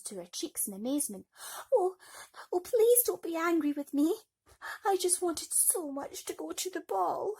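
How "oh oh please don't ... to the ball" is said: high, loud, tearful plea; pleading Cinderella. A narrator's reading. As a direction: beg her not to be angry.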